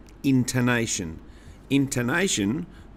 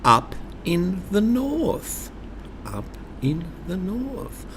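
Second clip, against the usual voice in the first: high north line; 9 semitones higher than usual. Shown as a demonstration. Teacher leading the poem's high north line. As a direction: lift the voice up north. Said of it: "Please say this after me".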